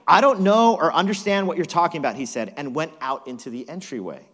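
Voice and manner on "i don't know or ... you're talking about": quick, loud, high protest; hurried loud disavowal; emphatic denial. Acted. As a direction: deny it fast and forcefully. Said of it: "But he denied it".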